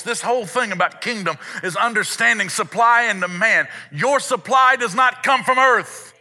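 high, driving sermon delivery; impassioned preaching. Real, not acted. Preacher teaching a congregation about supply, impassioned preaching. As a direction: proclaim it to the congregation, high and forceful. Preaches the point at full force.

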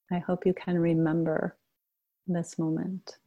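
guided meditation. Acted. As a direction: gently guide the group into shared stillness. Leads a group meditation.

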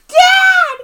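very high, loud cry; shouting. Retold or imitated. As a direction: scream it like a joyful kid. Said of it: "the way he was just like".

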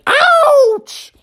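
squeaky bird yelp; high eagle yelp. Acted. Eagle struck by the slingshot, yelping. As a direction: yelp like a bird just hit.

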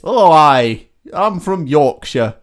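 loud, slow stab at Yorkshire; attempted Yorkshire accent. Shown as a demonstration. Trying out an accent for co-host, broad mock Yorkshire. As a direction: draw out a hammed-up Yorkshire accent. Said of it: "What's Yorkshire?".

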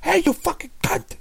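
high, fast nasty jab; harsh insult. Retold or imitated. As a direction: spit it out like a real insult. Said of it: "But I'm like".

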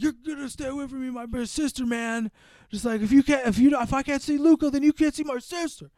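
high-pitched, heated protest; mock angry brother. Punched brother warning him off his sister, mock indignant. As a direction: imitate an aggrieved brother mid-fight. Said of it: "he picks a fight with the brother".

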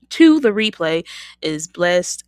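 ordinary talk

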